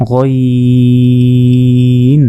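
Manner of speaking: গইন held very long from the end of the throat; drawn-out Arabic letter. Shown as a demonstration. Teacher models গইন for students, very slow. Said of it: "হলকের শেষ ভাগ হতে".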